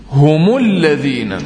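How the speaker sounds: هم said as humu, linked into الذين with a clear u; slow chanted humu lladhina; tajweed demo. Shown as a demonstration. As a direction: stretch هم into humu before الذين. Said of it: "فقلنا".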